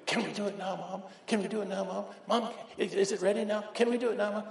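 put-on high, pleading child voice; breathless kids begging mom at dinner; eager little kids. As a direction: beg like an impatient little kid. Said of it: "the little kids are".

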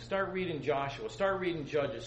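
normal talking voice

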